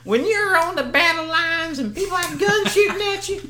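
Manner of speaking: hammed-up boss telling staff off about alarms; boss lecture impression. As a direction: mimic the manager scolding staff. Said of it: "He'd be like".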